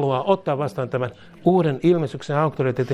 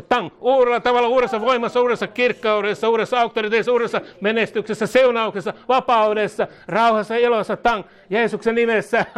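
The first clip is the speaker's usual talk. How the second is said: raised, rhythmic listing of blessings; fervent prayer declaration; 9 semitones higher, 3 dB louder than usual. Real, not acted. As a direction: pile up each blessing in a driving, higher voice. Chants blessings over someone.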